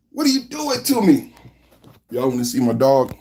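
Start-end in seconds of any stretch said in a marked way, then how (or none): none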